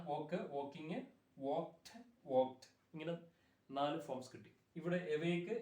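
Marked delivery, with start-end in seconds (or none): 0.1-2.7 "വോക്ക്ഡ്" ending in a hard t, like wokt; correct t ending. Shown as a demonstration. Teacher recapping verb forms for students, "വോക്ക്ഡ്" ending in t. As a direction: recite each form separately, "വോക്ക്ഡ്" said as wokt. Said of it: "അപ്പൊ എങ്ങനെയാണ്".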